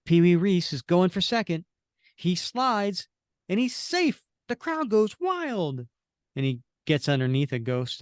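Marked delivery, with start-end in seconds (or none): 0.1-5.9 play-by-play climbing high; excited baseball commentary, rising to the finish; sports announcer. Acted. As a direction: build to a stretched, high-pitched crowd-goes-wild call.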